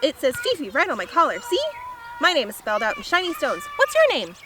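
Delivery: high, loud, fast chatter; small dog voice. A narrator's reading. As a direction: rattle on like a tiny excitable dog. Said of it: "says the small dog".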